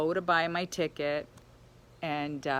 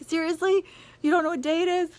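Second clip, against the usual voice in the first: pitched way up in shocked disbelief; incredulous disbelief; 10 semitones higher, 4 dB louder, faster than usual. Retold or imitated. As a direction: ask it as if you can't believe your ears. Friend asks what day it is.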